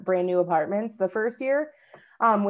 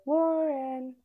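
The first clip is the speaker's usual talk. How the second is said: soft, drawn-out eerie call; ghostly call of a name; 5 semitones higher, 5 dB quieter than usual. Retold or imitated. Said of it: "you hear".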